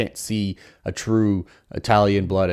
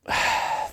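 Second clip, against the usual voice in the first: long breathy sigh; weary sigh; 4 dB quieter than usual. Real, not acted. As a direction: let out a sigh before carrying on. Pauses, sighing over the rules problem.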